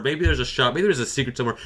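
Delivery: ordinary talk, nothing marked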